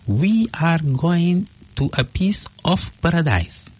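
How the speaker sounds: high, slow retelling of wonderful news; awed announcement. Retold or imitated. Wife telling husband what she found, awed. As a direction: savour each word of the big reveal.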